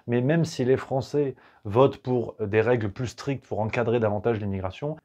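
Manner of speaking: ordinary delivery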